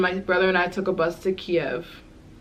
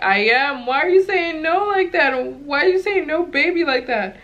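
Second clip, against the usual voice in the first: much higher, louder, offended questioning; indignant retort; 9 semitones higher, 3 dB louder than usual. Retold or imitated. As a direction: push back, put out and rising. Retold indignant reply to the clerk.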